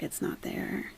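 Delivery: whispered aside. Real, not acted. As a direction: breathe it under your breath.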